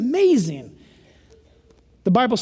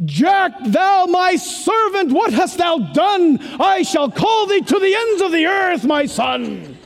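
grand voice of God; 7 semitones higher than usual. Pretend. Preacher mocks expected thunderous God, booming. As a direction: boom it out like a mountain-splitting God. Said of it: "speak it like".

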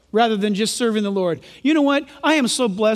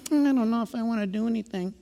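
soft, quick, half-hearted mumble; mock reluctance; 7 dB quieter, faster than usual. Preacher mimicking Christians unwilling to serve, mock-reluctant. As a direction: play someone who can't be bothered, quietly.

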